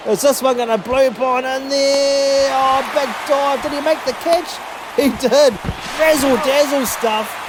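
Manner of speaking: rising astonishment through the diving catch; calling a razzle dazzle double play live; excited call. Real, not acted.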